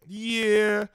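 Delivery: high, loud drawn-out mock "yeah"; mom's skeptical yeah. Imitating mom on Bernie, high skeptical yeah. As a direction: imitate mom conceding before her objection. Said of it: "my mom's main thing is like".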